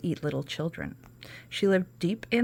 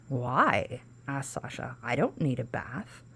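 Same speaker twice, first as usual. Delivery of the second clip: put-on lower, softer small girl; little girl; 4 semitones lower, 4 dB quieter, slower than usual. Sasha questioning the witch, lower and softer. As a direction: answer shyly as a little girl.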